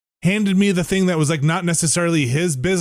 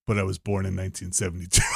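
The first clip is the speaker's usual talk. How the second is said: lower, softer and fast salesman aside; quiet mock salesman; 9 semitones lower, 7 dB quieter, faster than usual. Salesman revealing his real age, low quick mock voice.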